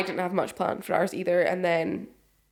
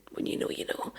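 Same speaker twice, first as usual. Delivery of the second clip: hushed mock wisdom; 8 dB quieter than usual. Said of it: "she just used to be like".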